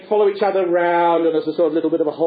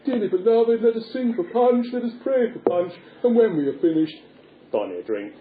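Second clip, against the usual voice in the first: puppet minister; 4 semitones higher than usual. Acted. Minister prays over Punch, mock-solemn chant with offhand finish. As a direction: intone a mock funeral prayer.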